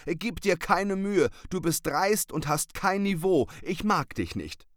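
stern. Acted. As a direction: lay down the law to him. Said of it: "antwortete Maurizio streng".

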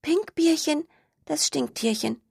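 high, light little skunk voice; sing-song rhyme, pleased with the idea. Acted. Skunk character voice.

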